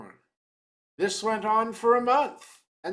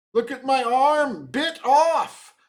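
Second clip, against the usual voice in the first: put-on indignant old man; 5 semitones higher, 6 dB louder than usual. A narrator's reading. Old man showing his wound.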